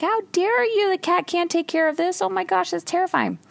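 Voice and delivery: high, appalled mock protest; mock outrage. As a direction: play up horrified indignation. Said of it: "And I was like".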